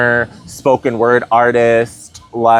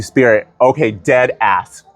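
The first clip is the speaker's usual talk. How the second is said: ordinary talk, nothing marked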